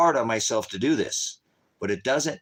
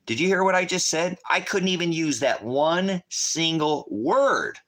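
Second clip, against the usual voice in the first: exasperated disbelief; 7 semitones higher than usual. Real, not acted. Exasperated about TikTok ban.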